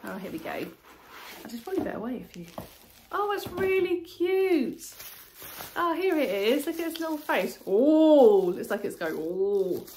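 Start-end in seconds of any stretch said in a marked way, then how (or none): 3.1-7.2 cooing delight. Real, not acted. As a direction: melt over how cute it is. Unboxing a cute face planter.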